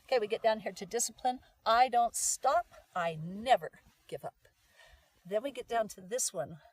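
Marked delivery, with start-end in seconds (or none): 1.6-4.5 loud, slow, punched affirmation; determined. Shown as a demonstration. Coach models the discipline affirmation, loud and determined. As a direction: declare it firmly, word by word.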